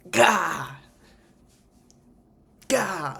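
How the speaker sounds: high, loud "gah" of excitement; excited squeal. Real, not acted. Overflowing with excitement about body work, excited cries. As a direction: burst out with two high cries of delight. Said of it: "I'm so excited".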